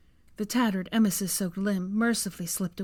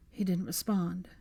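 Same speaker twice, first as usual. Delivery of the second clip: ordinary delivery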